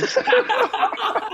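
loud high-pitched laugh; high burst of laughter. Real, not acted. Big laugh at the joke.

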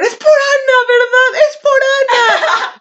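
high, loud teasing guess, slow at the end; excited guess. Retold or imitated. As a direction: gleefully catch the secret. Amigas adivinando con emoción. (adivinanza emocionada, aguda y fuerte)

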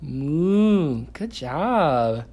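put-on high, sing-song voice for a small child; reading to a toddler. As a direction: coax a toddler, bright and playful.

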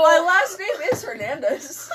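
high, giggling through words; laughing. Real, not acted. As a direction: crack up mid-line. Joke reveal, laughing hard.

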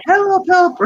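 put-on high, loud, panicked call of drowning kids; playful imitation of kids yelling for help; mock cry for help. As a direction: squeal it like kids in the water. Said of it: "these kids were like".

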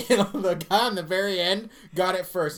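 ordinary talk, nothing marked

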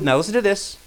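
usual speaking voice

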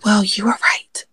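slow sheepish whisper. Acted. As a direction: whisper the confession slowly. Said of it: "I'm whispering because".

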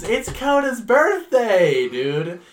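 put-on high, loud, slow drunken proclamation; drunk party cheer. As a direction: slur it out like the life of the party. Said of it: "starts drinking and goes".

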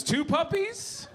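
high, slow, puzzled read-out; mom's status update. Acted. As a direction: stretch each word upward, baffled. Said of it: "Day two, it was".

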